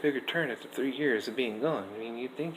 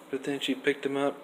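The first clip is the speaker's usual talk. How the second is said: ordinary delivery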